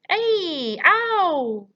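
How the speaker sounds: two high, very loud wails; roar. A narrator's reading. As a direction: howl out as the nightmare hits. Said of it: "roared the flesh lump eater".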